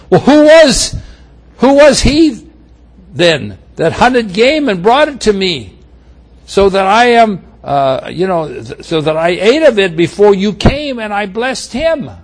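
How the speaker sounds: high, strained, shaken voice; a father realizing he's been tricked; trembling shock. Acted. Isaac questioning Esau, high and shaken. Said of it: "Isaac trembled violently".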